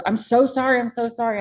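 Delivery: acted distressed apology; retold apology. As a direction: plead a repeated apology, lightly acted. Acts the dad apologizing.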